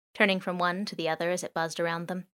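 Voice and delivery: usual speaking voice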